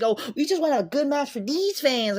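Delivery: high, loud fake earnestness; hammed-up whiny-high quote; mocking imitation. Mocking the wrestlers' earnest line.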